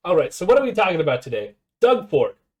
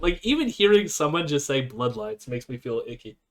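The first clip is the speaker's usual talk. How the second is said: normal talking voice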